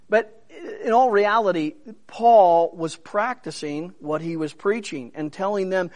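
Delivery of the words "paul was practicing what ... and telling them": normal talking voice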